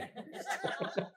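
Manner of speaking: high breathy burst of laughter. Real, not acted. Laughing at his own buffalo punchline, airy and high. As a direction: laugh airily at the buffalo joke.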